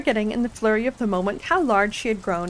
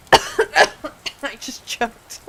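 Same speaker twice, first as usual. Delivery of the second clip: usual speaking voice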